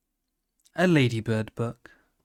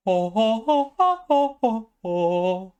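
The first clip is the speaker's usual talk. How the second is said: high-pitched jolly ho ho ho, much higher than his own voice; character laugh; 10 semitones higher, 5 dB louder, slower than usual. Acted. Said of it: "I'll go back into character".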